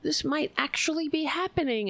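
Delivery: high-pitched hopeful amazement; retold excitement. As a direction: re-enact a rush of hope. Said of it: "I was like".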